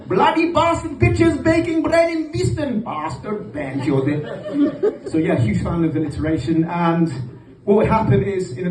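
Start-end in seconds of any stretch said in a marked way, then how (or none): 0.1-5.1 high dad voice, sinking into a slow laugh; alliterative dad rant. Retold or imitated. Comic imitating his dad's alliterative rant, high then laughing.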